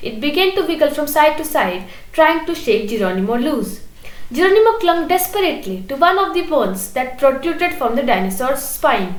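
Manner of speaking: ordinary delivery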